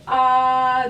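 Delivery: long open ah sound; sustained ah. Shown as a demonstration. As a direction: hold a steady ah. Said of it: "open up your mouth and say".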